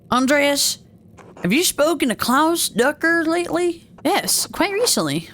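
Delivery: high, courteous game dialogue; lightly voiced polite character. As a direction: lift the pitch for polite conversation.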